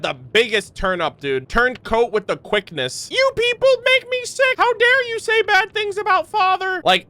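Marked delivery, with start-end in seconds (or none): 3.1-6.8 high, put-on whiny kid voice; whiny mock Foltz. Streamer mocking a traitor's outburst, high whiny voice. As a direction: sneer it out in a shrill fake voice.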